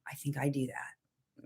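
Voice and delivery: slow whispered confession; soft slow whisper. Retold or imitated. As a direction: whisper it like a child admitting something. Child's inner thought retold.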